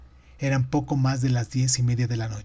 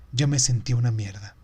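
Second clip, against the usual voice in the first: usual speaking voice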